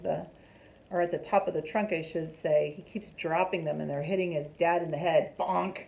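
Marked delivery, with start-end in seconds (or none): none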